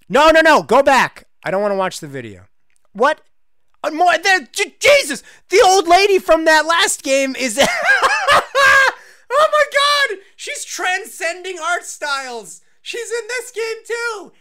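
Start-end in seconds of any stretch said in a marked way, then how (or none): 2.9-7.6 shouting high and loud, laughing; shocked yelp of recognition; loud, laughing disbelief; startled shout. Real, not acted. Shocked at recurring old lady. 7.6-9.0 a high, loud burst of laughter; real howl of laughter; shrieking laugh. Real, not acted. Loud laugh at old lady. 9.3-14.3 high-pitched, amazed and slowed; real delighted shriek; excited shouting. Real, not acted. Excited at old lady cameo.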